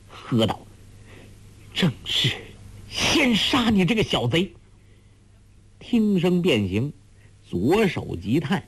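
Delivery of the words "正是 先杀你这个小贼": strained threat leaping up high; grief and fury. A narrator's reading. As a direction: snarl it out in grieving rage. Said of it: "悲怒交蹦".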